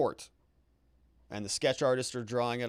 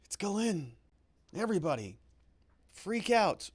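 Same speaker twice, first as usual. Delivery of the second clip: hammed-up panic, higher pitched; mock alarm; 6 semitones higher than usual. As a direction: play at being startled, teasing. Mock alarm at Maxwell's entrance.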